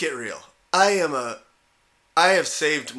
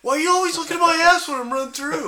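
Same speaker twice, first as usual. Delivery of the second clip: much higher and louder, laughing through it; mock flustered jogger; 7 dB louder, faster than usual. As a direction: shoo off a flirt in playful outrage. Jogger scolding the flirty coyote.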